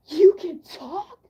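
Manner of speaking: loud, slow, hammed-up shock; dramatic disbelief. Mocking the classmate stunned she speaks, loud and slow. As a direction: overplay his stunned disbelief. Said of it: "he was so dramatic about it".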